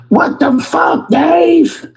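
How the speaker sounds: high, slow squeaky outburst; squeaky upset voice. Retold or imitated. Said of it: "he got this squeaky ass".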